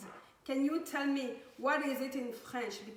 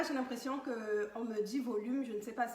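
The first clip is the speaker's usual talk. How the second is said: ordinary talk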